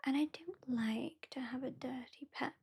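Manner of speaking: ordinary delivery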